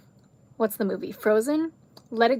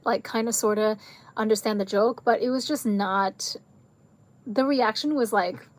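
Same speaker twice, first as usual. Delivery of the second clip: usual speaking voice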